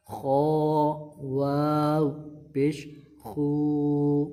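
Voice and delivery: خو خی said full and thick; drawn-out heavy syllables; heavy kh drill. Shown as a demonstration. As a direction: chant with heavy letters. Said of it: "اس کو موٹا پڑھیں گے".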